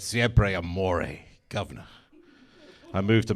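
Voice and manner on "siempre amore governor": very slow mock seduction, governor dropped low and soft; drawn-out hammed-up Italian lover. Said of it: "and said".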